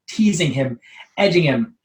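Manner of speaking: ordinary delivery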